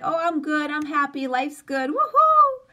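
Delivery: hammed-up cheer hiding what's underneath; pretend happiness. Pretending life's good to everyone, hammed-up cheer. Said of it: "we pretend like".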